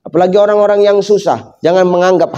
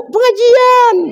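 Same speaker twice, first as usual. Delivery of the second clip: high, prissy mock reply; a high mock answer from the ring-laden wife; mock prim wife; much slower than usual. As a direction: chirp it like a showy woman.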